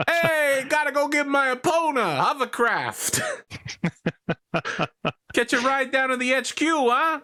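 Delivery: high, brash New York wise guy voice; high mock New York swagger; New York Hell's Horse. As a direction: play a cocky New Yorker clansman. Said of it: "what it means to be a Hell's Horse now. From New York".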